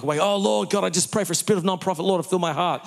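hammed-up, high-pitched plea to the Lord; mock prayer. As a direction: rattle off a fervent prayer in jest. Mock prayer he denies praying.

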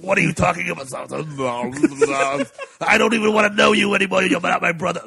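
loud, hammed-up action-hero bellow; Stallone. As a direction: bellow it like an overacting movie star. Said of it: "Stallone is just loud".

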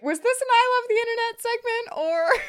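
high-pitched bewildered question; mock puzzled listeners. Co-host quoting listeners' reaction, mock bewilderment. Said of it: "which people were like".

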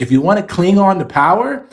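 high-pitched, loud, emphatic plea; worked-up exhortation. Real, not acted. Former congressman urging a better strategy, excited and emphatic. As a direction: pitch climbs sharply as you press the point. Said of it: "You sound so relaxed".

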